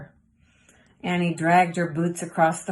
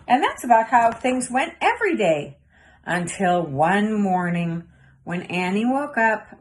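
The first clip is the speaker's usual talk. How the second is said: ordinary delivery, nothing marked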